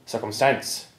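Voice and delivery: circumstance said slowly, stress on the last syllable; circum-STANCE, as said in Munster; Munster final stress. Shown as a demonstration. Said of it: "people in Munster would still say".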